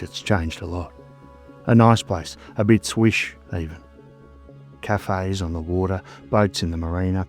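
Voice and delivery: ordinary delivery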